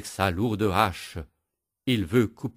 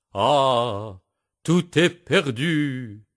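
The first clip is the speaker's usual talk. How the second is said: higher, louder, slow wail; despairing cry; 5 semitones higher, 5 dB louder, much slower than usual. Acted. Beaten woodcutter cries out in despair, slow wail. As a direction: wail in defeat. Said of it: "crie le bûcheron".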